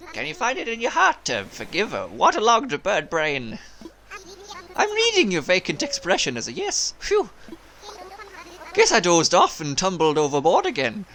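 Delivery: lilting Irish-tinged sailor bird; Irish accent. Acted. Waterlogged bird asks forgiveness, in an Irish lilt. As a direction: let the sailor slip into Irish. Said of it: "Oh no, he's turning Irish".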